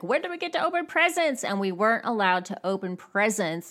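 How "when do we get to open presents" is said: put-on high, rushed voice of eager kids; imitating impatient children, fast and higher. Kids at Christmas Eve dinner begging for presents, high impatient whine. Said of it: "all the kids would be like".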